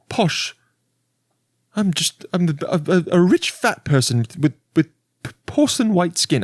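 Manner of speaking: high, mock posh British voice; posh British lady. Rich lady describing herself, high posh British voice. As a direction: send up a dainty British snob. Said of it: "she talks high like that".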